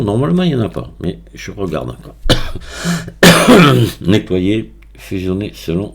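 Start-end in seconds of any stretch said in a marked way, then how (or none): 2.2-4.0 harsh dry cough; a real hacking cough. Real, not acted. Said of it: "je tousse toujours beaucoup".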